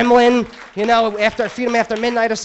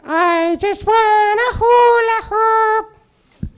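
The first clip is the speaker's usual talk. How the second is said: much higher, very slow chipmunk whine; much higher, squeaky drawn-out plea; Alvin the Chipmunk; 13 semitones higher, 4 dB louder, much slower than usual. Retold or imitated. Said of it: "sounds just like Alvin the Chipmunk".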